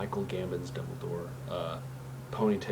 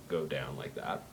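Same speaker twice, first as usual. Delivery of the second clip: dropped low and softer; deep voice; 6 semitones lower, 6 dB quieter than usual. Shown as a demonstration. Showing viewers how to add depth, low voice. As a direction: let the voice sink down deep. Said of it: "have a depthness to your voice".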